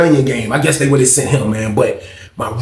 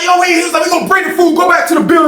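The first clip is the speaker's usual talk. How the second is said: high shouted order; mock guard yell; imitated shout; faster than usual. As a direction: call it out high and urgent. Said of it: "He's like".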